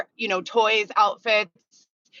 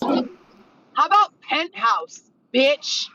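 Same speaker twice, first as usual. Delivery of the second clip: mocking; 9 semitones higher, much slower than usual. Sarcastic jab at autocorrect.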